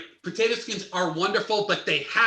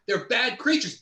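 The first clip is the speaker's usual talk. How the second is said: normal talking voice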